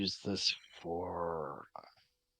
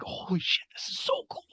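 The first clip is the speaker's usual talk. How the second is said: hushed, giddy fan excitement; giddy awe; 3 dB quieter than usual. Acted. Crew marvel at Winston's secret, giddy and hushed. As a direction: gush it under your breath. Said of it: "crew members are giddy at this point".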